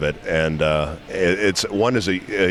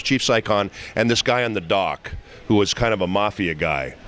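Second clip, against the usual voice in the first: normal talking voice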